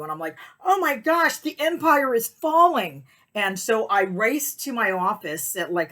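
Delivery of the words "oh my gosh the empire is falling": high, loud theatrical wail; mock panic. Seller facing complaints, hammed-up panic. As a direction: cry doom, drawing out the end.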